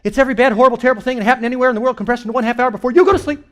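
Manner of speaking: normal talking voice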